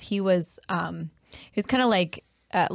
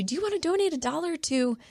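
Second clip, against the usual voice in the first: lightly voiced cashier, pitched up; higher-pitched mock donation request; checkout clerk asking; 9 semitones higher than usual. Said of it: "the person asks you".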